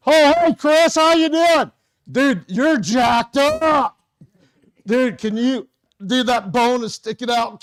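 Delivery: high, loud frantic babble; panicked helper. Shown as a demonstration. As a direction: play the panicking rescuer who scares the victim. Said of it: "Stressed you out a little bit".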